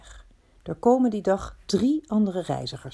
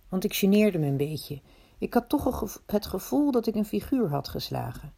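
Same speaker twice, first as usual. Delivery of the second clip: ordinary delivery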